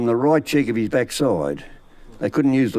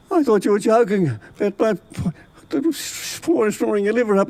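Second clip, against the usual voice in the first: put-on sick old man; 9 semitones higher than usual. Sick Charlie quoted, voice far.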